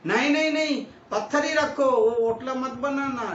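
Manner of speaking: imitated high, loud refusal; objecting trustees. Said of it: "ऐसे समाज वाले ये ट्रस्टी भी". (ट्रस्टियों की नकल में ऊँची, ज़ोरदार मनाही)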